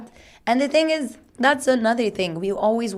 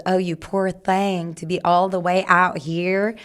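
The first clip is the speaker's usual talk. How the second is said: put-on Southern accent. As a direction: imitate a pitying Southern shop lady in a Southern accent. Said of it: "That was a very good Southern accent".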